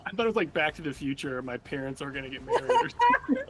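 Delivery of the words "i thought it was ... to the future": ordinary talk, nothing marked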